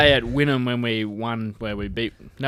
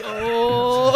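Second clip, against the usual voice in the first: laughing mock surprise; 12 semitones higher, 4 dB louder than usual. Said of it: "we were just like".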